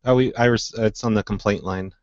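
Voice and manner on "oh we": ordinary talk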